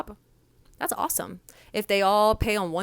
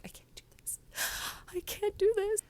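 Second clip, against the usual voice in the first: imitated tearful breakdown; 13 semitones higher, 5 dB quieter than usual. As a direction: whisper rising to a high gasping whimper. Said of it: "and she's in tears".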